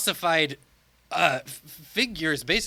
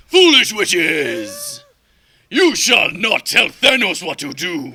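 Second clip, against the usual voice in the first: very slow, loud, higher booming tyrant shout; Mad Titan voice; 5 semitones higher, 9 dB louder, slower than usual. Acted. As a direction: roar it like a hammed-up mad god. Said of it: "do them in your Mad Titan voice".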